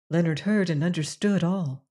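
plain reading voice

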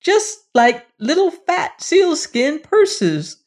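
squeaky creature denial; dwarfs. Acted. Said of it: "they said".

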